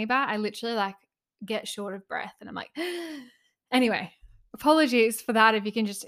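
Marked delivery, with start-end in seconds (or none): 2.7-3.2 sharp gasp. Shown as a demonstration. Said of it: "I'm so out of breath today".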